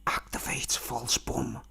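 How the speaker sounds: urgent breathy whisper, slow. Retold or imitated. Boss Nana secretly whispering to his utility skin, slow. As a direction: hiss it secretly to the suit. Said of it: "Boss Nana whispers".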